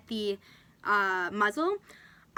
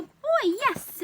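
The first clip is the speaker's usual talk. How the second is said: much higher and louder, cheering the dog; excited dog praise; 13 semitones higher, 6 dB louder than usual. Real, not acted. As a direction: burst of happy praise for the dog. Praising dog as muzzle comes off.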